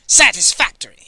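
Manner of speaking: loud, gruff grunt from a huge woman; grunted verdict. A narrator's reading. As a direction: bark it out like inspecting cattle. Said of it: "she grunted".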